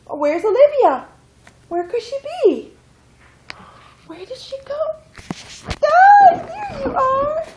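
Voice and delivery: rising loud seeking voice; excited peekaboo. Pretend. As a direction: build up to a loud found-you.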